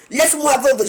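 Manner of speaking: mock plea. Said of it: "tu vas crier".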